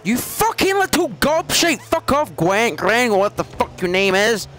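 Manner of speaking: furious yell. Real, not acted. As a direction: rage at the little pest. Raging at opponent player.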